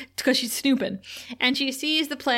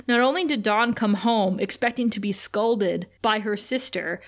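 ordinary talk

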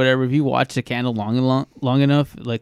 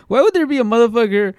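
incredulous rant; 6 semitones higher, 4 dB louder than usual. Retold or imitated. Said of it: "I was like".

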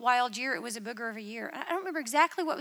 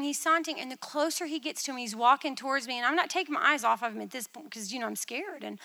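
normal talking voice

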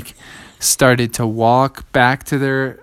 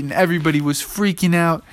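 ordinary delivery, nothing marked

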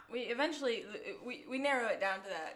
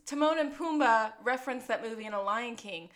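usual speaking voice

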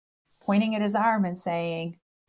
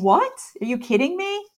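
incredulous laugh; 9 semitones higher, 6 dB louder than usual. Retold or imitated. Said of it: "He's laughing, saying".

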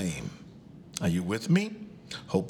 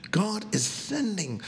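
high slow echo; 10 semitones higher than usual. Real, not acted. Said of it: "repeated sending".

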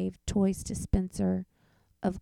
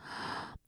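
gasp; 11 dB quieter than usual. Acted. Boy's shocked reaction.

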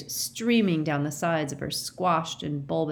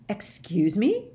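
incredulous; 5 semitones higher, slower than usual. A narrator's reading. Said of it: "said Mister Diggory incredulously".

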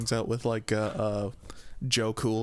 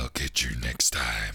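much lower guttural villain threat; Doctor Claw; 14 semitones lower than usual. Retold or imitated. After guessing the cartoon, the low guttural villain line. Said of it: "a little more guttural".

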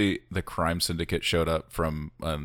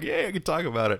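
excited; 11 semitones higher than usual. Retold or imitated. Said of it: "and I'm like".

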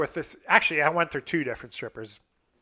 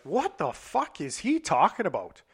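mock-indignant disbelief, pitched up; retold fuming; 6 semitones higher than usual. Telling friends how he reacted to Chad's text, fuming. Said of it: "like, fuming".

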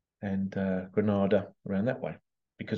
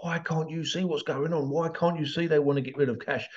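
higher-pitched, mildly acted exasperation; exasperated pleading; 5 semitones higher than usual. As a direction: voice someone whose tolerance is running out. Voicing people losing their tolerance.

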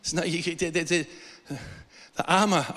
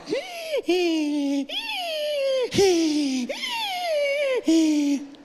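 long high squealing wheeze, in and out; imitated wheezing. Walking up a hill in Queensland unable to breathe, imitated wheezing. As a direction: act out straining for breath with drawn-out squeals.

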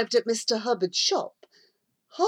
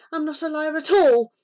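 louder, higher defiant shout; shouted denial; 9 semitones higher, 7 dB louder than usual. A narrator's reading. Girl defending herself to the matron, louder shout. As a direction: shout it back at her. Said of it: "Mary shouted".

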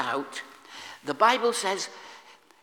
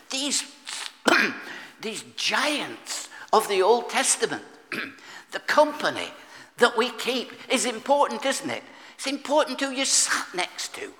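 preaching at full force; 4 semitones higher, 4 dB louder than usual. Acted.

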